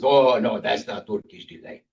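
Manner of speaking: lightly imitated Turkish accent, drawing out the correction; mock Turkish-accented insistence; Turkish vendor. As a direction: correct the customer as a Turkish market seller. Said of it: "He says".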